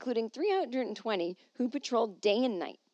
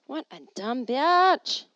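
slow, louder self-scolding; self-mocking; 5 semitones higher, 8 dB louder, slower than usual. Real, not acted. She scolds herself for reading her own note aloud, slowly. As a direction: drag yourself for a silly mistake, slow and loud. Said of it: "reading it out loud like it's a thing".

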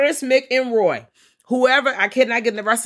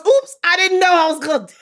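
high, loud fake apology; mock innocent shout; 13 semitones higher, 9 dB louder than usual. Monster after biting her finger, loud fake-innocent oops. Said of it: "and he goes".

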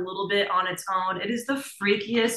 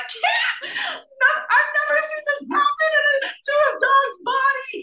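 much higher, loud panicked shriek; panicked scream to Cam; 4 dB louder than usual. Retold or imitated. Wife screaming to Cam about the dog, panicked shriek. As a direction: shriek at your husband in horror. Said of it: "I screamed to Cam".

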